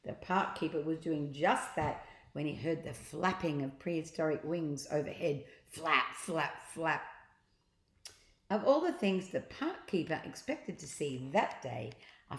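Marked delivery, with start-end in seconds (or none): none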